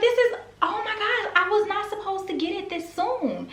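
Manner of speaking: very high, laughing disbelief; giddy, can't believe it; overjoyed. Retold or imitated.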